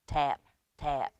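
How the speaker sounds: plain reading voice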